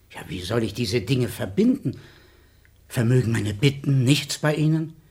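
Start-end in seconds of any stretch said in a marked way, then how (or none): none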